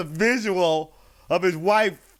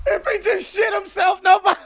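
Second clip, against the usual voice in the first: laughing hard; 13 semitones higher than usual. Real, not acted. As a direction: shout it while cracking up. Cracking up at the joke.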